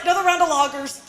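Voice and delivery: calling the waitress. Retold or imitated. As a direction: call out to the waitress twice. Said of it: "waving to the waitress".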